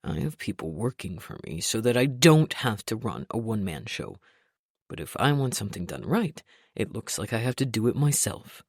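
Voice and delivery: low, complaining boss rant; gruff self-pitying grumble; grumpy boss voice. A narrator's reading. Trent rants in lower voice.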